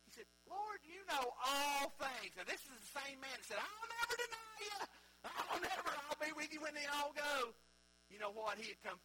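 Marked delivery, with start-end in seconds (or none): 0.5-2.3 slow, drawn-out exasperated plea, pitched higher; Peter's frustrated cry, stretched slow and high; aggravated. Retold or imitated. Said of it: "he got aggravated". 3.6-7.5 high, loud, eager promise; Peter's proud oath, pitched up and loud; boastful vow. Retold or imitated.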